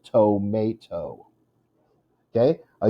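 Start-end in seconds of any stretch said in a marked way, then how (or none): 0.1-1.3 slow "tomato" as toe, MAY, toe; slow separated syllables in "tomato", stress on MAY. Shown as a demonstration. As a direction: sound out "tomato" in three slow beats. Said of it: "It's the second syllable".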